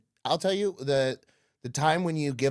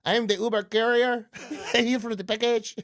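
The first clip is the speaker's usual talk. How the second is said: higher, hammed-up accented delivery driver; put-on courier voice; 10 semitones higher than usual. Uber driver announcing himself at pickup, comic accented voice. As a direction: play a comic foreign courier. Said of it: "voices are fun to do".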